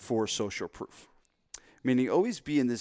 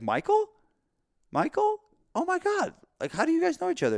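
very high, astonished recognition; hammed-up high-pitched disbelief; delighted surprise; 3 dB louder than usual. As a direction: act amazed to bump into a friend. Acted friend spotting the trainer.

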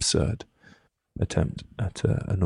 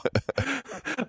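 high laugh; 4 dB quieter than usual. Real, not acted. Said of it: "that makes me laugh".

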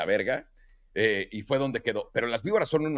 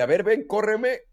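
higher teasing dare; coyote taunt; 9 semitones higher, 3 dB louder, slower than usual. Retold or imitated. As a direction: voice the coyote daring him. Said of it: "Era de".

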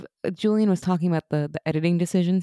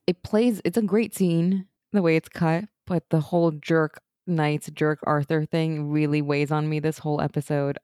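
ordinary delivery

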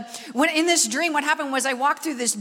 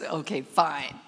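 deep, slow, begrudging voice; grudging sarcasm; 8 semitones lower, slower than usual. Retold or imitated. Preacher grudgingly agreeing to ask God, deep and sarcastic. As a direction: give in with heavy reluctant sarcasm. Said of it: "I said".

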